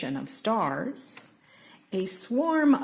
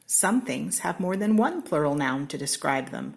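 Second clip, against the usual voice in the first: usual speaking voice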